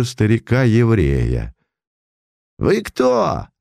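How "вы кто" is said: sharp, much higher demand; pouncing demand. A narrator's reading. Mordukhai rounds on the old man, sharply. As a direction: snap at the stranger. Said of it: "набросился на него Мордухай".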